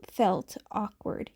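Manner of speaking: plain reading voice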